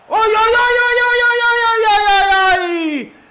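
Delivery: high, loud string of ay cries; ecstatic shout. Real, not acted. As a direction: explode with joy at the goal. Goal scored, commentator erupts.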